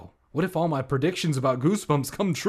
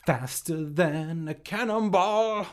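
dramatic voice; 5 semitones higher, 4 dB louder, slower than usual. Acted. Joke aside in dramatic voice.

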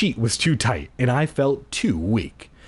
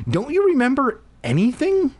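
sister taunting. Acted.

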